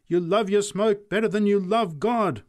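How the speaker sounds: high, loud accusation; preacher's rebuke. A narrator's reading. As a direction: reproach the whole crowd from the pulpit.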